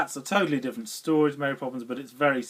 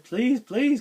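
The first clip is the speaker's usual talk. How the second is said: high, louder mock begging; imitated pleading; 11 semitones higher, 5 dB louder than usual. Walt Disney asking a writer for film rights, imitated pleading. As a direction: plead eagerly, voice jumping up.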